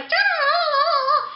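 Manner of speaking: loud, sustained high "ah"; exaggerated high-pitched holler; imitated high wail. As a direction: hold one piercingly high, loud note in mockery. Imitating parents around a bratty kid.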